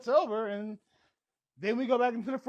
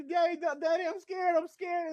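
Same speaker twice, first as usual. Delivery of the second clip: put-on higher, a light child's fright; scared little girl; 8 semitones higher than usual. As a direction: call for daddy like a scared kid.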